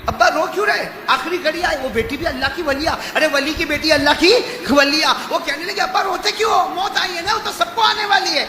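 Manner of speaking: high, loud, pleading questions; impassioned preaching. Acted. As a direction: cry out as the questioning daughter. (بلند آواز میں جذباتی سوال)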